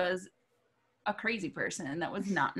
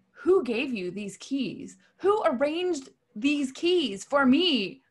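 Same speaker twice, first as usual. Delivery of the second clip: slow, drawn-out accusing voice; imitating diva's demand; 5 semitones higher, 4 dB louder, much slower than usual. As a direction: grill them like an offended star. Angry artist demands answers.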